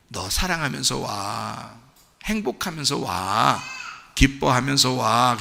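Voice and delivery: low, slow, soft beckoning; gentle invitation. Acted. The Lord inviting us to come in joy, gentle. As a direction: draw out each tender invitation. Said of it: "그러는데".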